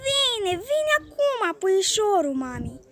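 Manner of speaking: put-on slow, high cooing old lady; doting grandmother. A narrator's reading. Grandmother calms her impatient grandson, cooing slowly. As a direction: soothe the spoiled boy, drawn out. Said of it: "răspunde cucoana".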